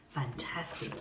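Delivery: whispered praise. Acted. Praising children.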